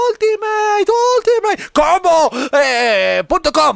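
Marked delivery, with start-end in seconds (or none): 0.1-2.3 high arcade combo shout; fighting game announcer. Acted. Fake ad, combo call. (anunciador de videojuego de peleas)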